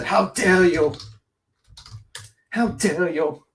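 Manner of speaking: lightly put-on indignant girl voice; two half-serious scolding repeats; imitating Greta Thunberg. Quoting the climate activist to his co-host, mild mock scolding. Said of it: "Greta Thunberg, when she said".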